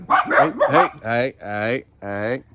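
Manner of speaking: sharp loud hey, hey to get attention; calling the dogs. Real, not acted. Owner calling the dogs in the garden, loud call.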